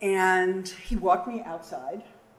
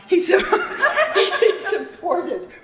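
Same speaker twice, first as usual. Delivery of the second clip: slowed, louder, laughing through it; 13 semitones higher, 12 dB louder, slower than usual. Real, not acted. To the memorial audience after her own slip, laughing. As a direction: giggle over the slip, then carry on. Joking about still being alive.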